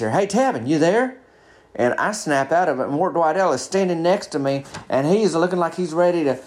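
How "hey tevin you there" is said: raised pitch, calling to a dazed pal; checking on friend. Retold or imitated. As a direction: try to snap a friend to attention, pitched up.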